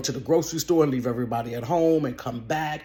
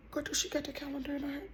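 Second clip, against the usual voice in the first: high, fast, hushed alarm; frantic whisper to her friend; panicked hush; 12 semitones higher, 12 dB quieter, faster than usual. Retold or imitated. Panic seeing the calendar.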